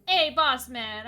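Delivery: slow, loud, drawn-out greeting, only lightly put on; a light take on Jackson Bones greeting the boss; loosely voiced Jackson Bones; Jackson Bones impression. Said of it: "my best Jackson Bones impression".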